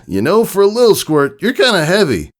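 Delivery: straining to talk while carrying a load; winded Rainbow Dash teasing mid-flight; between breaths. Acted. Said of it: "Rainbow Dash said between breaths".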